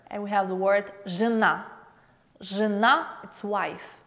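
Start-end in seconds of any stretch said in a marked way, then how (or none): none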